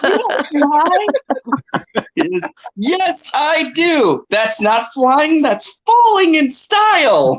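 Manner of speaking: high, slow, drawn-out toy character quote; movie line impression. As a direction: play the cartoon line up high, stretching it out. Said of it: "That's one of my favorite lines".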